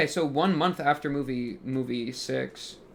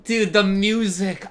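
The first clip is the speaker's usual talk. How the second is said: awed exclamation; 7 semitones higher, 9 dB louder, slower than usual. Real, not acted. Reacting to the soundtrack.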